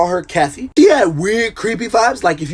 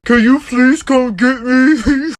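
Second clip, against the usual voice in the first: swollen-lip plea; 6 semitones higher, much slower than usual. Retold or imitated. Swollen lip, begging ride.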